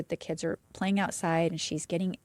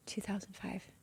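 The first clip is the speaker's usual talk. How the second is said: much softer, tossed-off two thousand five; muttered misreading; 14 dB quieter than usual. Shown as a demonstration. Host mumbles the misread year as a quiet slip. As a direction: mumble the wrong year under the breath. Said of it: "I do this all the time".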